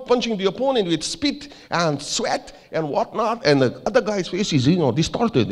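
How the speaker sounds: emphatic mock Norwegian, climbing high; bad Norwegian accent. Norwegian manager briefs photographer on boxing shot, bad Norwegian accent. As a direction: build up excitedly in a hammy Norwegian accent. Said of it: "I'm doing a very bad accent".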